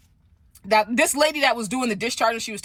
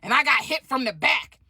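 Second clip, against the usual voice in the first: indignant shout; 2 semitones higher than usual. Retold or imitated. Stud shouting at the orderly that she drives for Lyft, indignant. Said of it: "She said".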